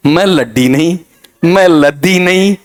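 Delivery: लद्दी with a heavy doubled d after a stretched "mel"; mispronunciation shown. Shown as a demonstration. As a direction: drag out मैं लद्दी as the wrong form. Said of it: "real में क्या कहा जाएगा".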